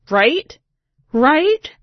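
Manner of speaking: incredulous, pitch going up each time; rising disbelief. Real, not acted. To the listener, reliving the kid's question, climbing disbelief.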